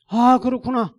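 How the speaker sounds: high, loud cry of realisation; emphatic realisation. Retold or imitated. Pastor grasping God's rebuke, loud emphatic realisation. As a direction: proclaim it as a sudden revelation. Realisation repeated at full force.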